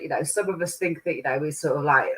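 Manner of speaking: ordinary delivery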